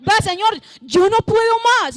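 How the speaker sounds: high, loud cry of distress; anguished plea. Acted. Preacher voices the afflicted crying out to God, anguished. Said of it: "de ese angustiado".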